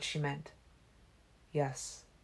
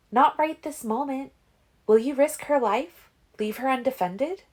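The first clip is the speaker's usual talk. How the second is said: higher, louder pleading voice; urgent Alice; 10 semitones higher, 6 dB louder than usual. A narrator's reading. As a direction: push him hard with urgency.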